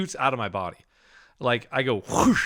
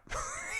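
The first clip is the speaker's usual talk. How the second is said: soft breathy laugh; 13 dB quieter than usual. Real, not acted. Laughs at himself.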